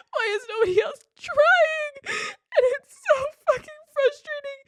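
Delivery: tearful, voice cracking high; crying. Real, not acted. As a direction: fight through tears, words breaking. Crying while venting about sister.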